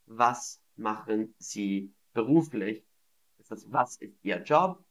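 ordinary talk

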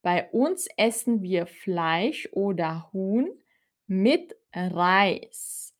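normal talking voice

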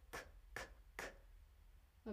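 whispered, voiceless "k, k, k" with the sound alone. Shown as a demonstration. Teacher isolating the k sound for students, voiceless. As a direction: breathe out a clipped "k" three times, no voice. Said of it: "con respecto al sonido k".

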